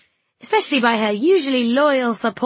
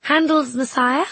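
hopeful suggestion; 5 semitones higher, 5 dB louder, slower than usual. A narrator's reading. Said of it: "I called".

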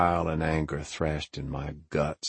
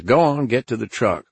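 loud, higher-pitched command; urgent order; 5 semitones higher, 7 dB louder than usual. Acted. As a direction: send him off with a firm, raised voice. Ordering him out as police arrive.

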